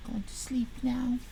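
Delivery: whispered going to sleep. Acted. Mocking a viewer who says he is off to sleep, whispered. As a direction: breathe it out with no voice.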